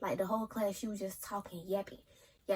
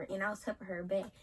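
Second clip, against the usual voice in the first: ordinary talk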